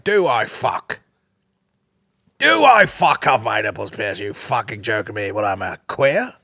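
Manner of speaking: put-on indignant denial; slow, emphatic mock outrage in another man's voice; Fred. Man asked about pierced nipples, imitated scornful outrage.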